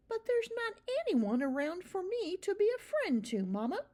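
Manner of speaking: put-on high, earnest young mouse voice; a small child mouse pleading; little mouse boy. A narrator's reading. Young mouse tells his mother, high child voice.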